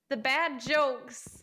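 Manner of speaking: mock accusing. Teasing friend about dad jokes.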